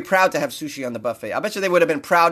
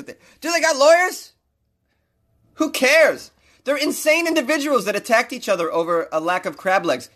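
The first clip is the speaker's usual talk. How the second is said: getting worked up; 5 semitones higher than usual. Real, not acted. Comedian ranting at news articles, worked up and exasperated. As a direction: build an outraged rant about lawyers. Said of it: "I'm getting a little excited".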